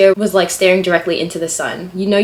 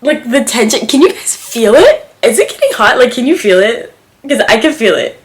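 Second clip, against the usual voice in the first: loud, higher, playful excitement; giddy teasing; 4 semitones higher, 8 dB louder than usual. Real, not acted. Asking viewers if they feel it, giddy. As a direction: fan yourself over the tension. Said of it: "I'm getting so giddy".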